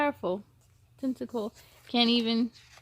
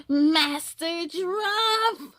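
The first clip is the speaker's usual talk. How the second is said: urgent call; 7 semitones higher, much slower than usual. Acted. Henchman rushes in with news.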